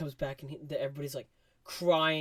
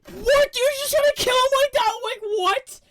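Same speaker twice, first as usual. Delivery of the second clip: shrill, much higher, loud; shocked disbelief; 14 dB louder than usual. Real, not acted. Vlogger reacting to Rocket flatlining, shocked. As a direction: squeal in outrage. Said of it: "reacting to Rocket dying".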